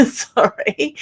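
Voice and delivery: laughs, then a sorry; embarrassed little laugh into an apology; sheepish laugh. Real, not acted. Apologising to her mum for forgetting to ask, with a sheepish laugh.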